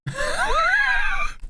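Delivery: long high squeal of laughter. Real, not acted. Laughing at the prime minister story.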